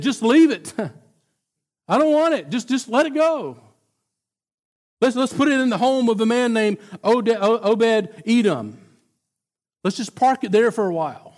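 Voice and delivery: nervous David. Acted. Frightened David backing off.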